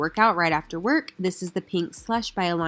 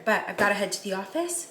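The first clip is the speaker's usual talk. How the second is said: normal talking voice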